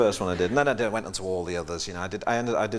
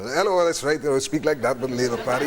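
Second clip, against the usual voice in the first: put-on politician impression, louder at the start; mimicked party leader, starting raised; Neil Kinnock; 3 semitones higher, 4 dB louder than usual. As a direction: launch into a satirical politician's voice. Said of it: "I can do Neil Kinnock".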